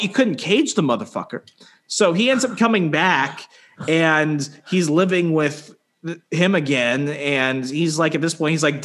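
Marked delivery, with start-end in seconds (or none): none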